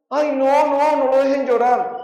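high-pitched mock panic; exaggerated, high worried plea; alarmed mourner. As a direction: imitate a fussing relative at a funeral. Imitates alarmed mourners at wakes.

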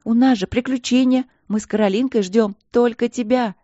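high, loud and eager; indignant, excited shouting. A narrator's reading. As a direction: urge her loudly down the phone. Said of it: "негодующе кричала Пол в трубку".

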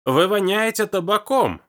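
high, loud, slow shriek. A narrator's reading. Old woman shrieking as Hugo leans in, loud and high. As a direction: screech it as you recoil. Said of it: "снова заверещала".

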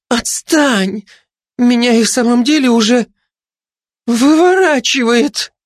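high, strained protest; distressed plea. A narrator's reading. Glutton is about to retch, distressed. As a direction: fend her off, slowing on the last word. Poisoned glutton begs off attacker.

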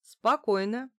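brisk authoritative steadying; ordering. A narrator's reading. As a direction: order the panicking person to settle. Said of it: "велела я".

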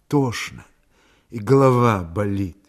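weak, muted, slightly low reply; dull and lifeless. A narrator's reading. Sick woman answers her worried man, dull and lifeless. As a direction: let the voice go dull and drained. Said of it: "Голос у неё был глухой и безжизненный".

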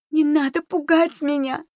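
panicked shrill protest; hysterical plea. A narrator's reading. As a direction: wail it at high pitch to block her out. Hysterical girl cuts friend off.

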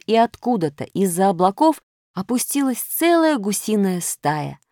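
usual speaking voice

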